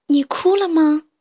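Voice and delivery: put-on a sweet girl asking gently. Girl asks tenderly. (罗西娜关切地问)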